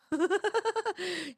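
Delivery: real laugh. Real, not acted. Laughs at a chat message.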